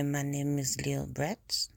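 slow French accent. Shown as a demonstration. Showing how the French would say the name, French accent. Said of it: "if I was French".

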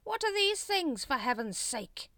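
slow, high, loud exasperation; incredulous leader's scolding; exasperated. A narrator's reading. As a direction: demand an answer, stretching the words. Grand Master exasperated by offerings.

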